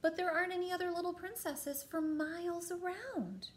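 high-pitched protesting queen; storybook queen. A narrator's reading. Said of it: "said the queen".

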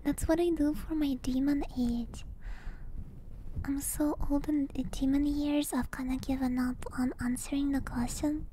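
soft breathy whisper close to the mic; ASMR whisper. Acted. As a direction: whisper as if lulling viewers to sleep. Whispered ASMR stream chat.

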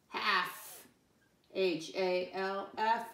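slow, separated letters; spelling aloud. Shown as a demonstration. Teacher spells a word with pupils, slow spelling aloud. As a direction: say each letter slowly and distinctly.

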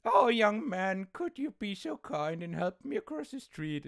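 a lightly voiced sweet old lady pleading; old grandma. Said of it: "she's like".

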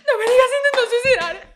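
high, loud panic broken by laughter; laughing fake panic. Retelling her lie to the officer, laughing fake panic. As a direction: repeat the lie while laughing.

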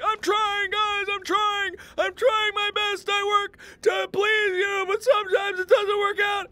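high, loud mock wailing; squealing tearful self-parody; hammed-up crying. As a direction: plead through exaggerated, drawn-out sobs. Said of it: "I cry and cry and cry".

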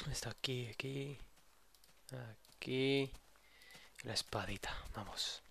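hushed whisper to himself. Real, not acted. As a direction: whisper it under breath. Grabbing sword quietly.

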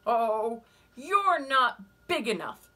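loud, high, slow and sneering; scornful ladybug voice. Acted. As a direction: dismiss them from up high.

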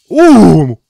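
loud, high "ooh"; thrilled whoop. Real, not acted. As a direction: whoop at a huge hit. Thrilled reaction to a strong hit.

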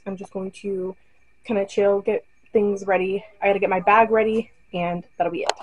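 usual speaking voice

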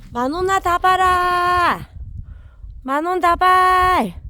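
slow, drawn-out, loud high calls; long-distance call. Real, not acted. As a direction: stretch each name out to carry far. Long calls to far-off dogs.

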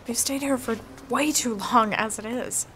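normal talking voice

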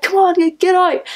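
high-pitched mock shouting, imitating someone; urgent ordering. Drunk girl dragging her friend from the room, urgently. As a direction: hurry them out, pitched right up.

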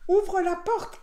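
storybook wolf shout; shouted command. A narrator's reading. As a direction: call out to be let in. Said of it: "cria le loup".

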